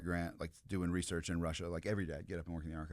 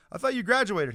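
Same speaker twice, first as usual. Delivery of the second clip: surprised; 12 semitones higher, 10 dB louder than usual. Retold or imitated. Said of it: "and I was like".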